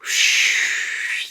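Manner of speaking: long breathy sigh; contented sigh. Acted. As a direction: sink into the cushions. Dad settles into chair.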